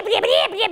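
high squawking bleeps; rooster noises. A narrator's reading. Raging rooster scratching the emperor, high squawks.

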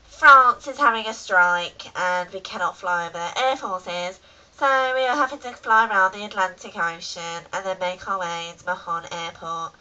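measured tannoy delivery, imitating the cockpit; airline captain. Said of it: "And they're like".

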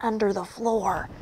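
hushed, very soft warning; whispered. A narrator's reading. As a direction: whisper it as a wary secret. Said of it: "whispered Percy".

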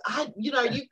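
high, breaking voice in stunned disbelief; overwhelmed disbelief. Retold or imitated. Retelling how she reacted to the acceptance news, high and breaking. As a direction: squeal it out, nearly crying.

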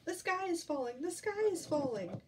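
high, lightly voiced squawk; storybook chick's high cry. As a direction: raise the alarm like a chick.

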